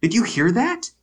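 imitating a stunned wife at her husband's insight; mock shocked Jill. Co-host imitates Jill stunned by Tim's insight, high mock shock. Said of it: "Jill is shocked".

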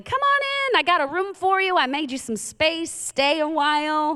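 high, singsong, hammed-up invitation; mock welcoming host. Host inviting the devil in, exaggerated high-pitched welcome.

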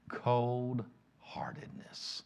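whispered very slowly and softly; slow hushed emphasis. Acted. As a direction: let the word hang in a hush. Dwelling on the word.

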